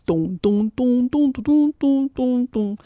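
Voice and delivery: dum-dum bass imitation; bass sound. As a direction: sing the bass line as it climbs. Went Lou's big brown paws on the stand-up bass.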